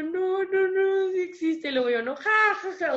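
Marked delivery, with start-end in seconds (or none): 0.0-1.7 high, loud, urgent no no no; insistent denial. Retold or imitated. Retelling how she argued with herself alone in the bathroom, high and insistent. Arguing with herself about God. 2.0-2.9 high, loud ha ha ha, half forced; mock laugh. Laughing alone at herself after praying aloud, high and loud mock laugh. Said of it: "me quedaba callada y me reía".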